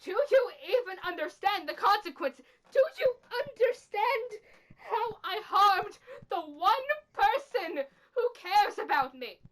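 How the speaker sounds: high, pressing, near-tearful demand; anguished accusation. Acted. Confronting the culprit over a harmed friend, anguished accusation.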